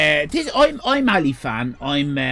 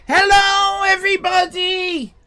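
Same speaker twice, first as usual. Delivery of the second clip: shouted greeting; 8 semitones higher than usual. Acted. Host shouting hello to the viewers. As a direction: call out the big hello.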